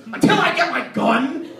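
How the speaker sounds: high, loud mock declaration; brother's answer. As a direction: imitate a blurted, emphatic interview answer. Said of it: "She goes".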